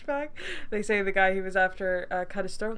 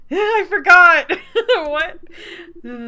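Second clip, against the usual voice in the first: loud, slow, high laughing cry; laughing outburst; 9 semitones higher, 16 dB louder, slower than usual. Real, not acted. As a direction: crack up mid-word in disbelief. Bursts out laughing at the news.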